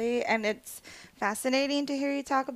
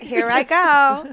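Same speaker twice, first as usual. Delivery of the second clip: sing-song; 3 semitones higher, 9 dB louder, much slower than usual. Retold or imitated. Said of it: "she'll be posting".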